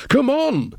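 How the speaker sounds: very high-pitched urgent cry; impatient shout over the shoulder, pitched right up; urgent call. Acted. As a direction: call back to her to hurry. Calls out while hurrying away.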